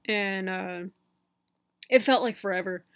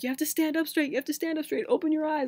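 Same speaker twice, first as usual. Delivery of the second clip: higher imitation of nurses giving orders; lightly voiced bossy nurses, pitched up; mock nurse orders; 7 semitones higher than usual. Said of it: "they're like".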